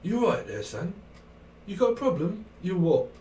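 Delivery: high-pitched London confrontation; London gangster film voice. Acted. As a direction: pick a fight like a film hard man. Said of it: "It's a bit like Lock, Stock".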